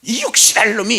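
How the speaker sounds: high, loud mock scolding; imitating mother cursing the disobedient boy; angry mother's curse. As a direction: snap it like an angry mum. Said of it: "화가 나셔서".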